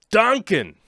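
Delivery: put-on shouted name in a Boston accent; hammed-up Boston accent yell. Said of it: "Boston accent or something".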